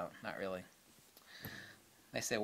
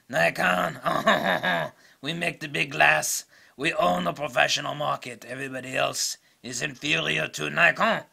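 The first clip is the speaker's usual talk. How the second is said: smug Nikon boast; 3 semitones higher, 8 dB louder than usual. Retold or imitated. Speaking as smug Nikon company.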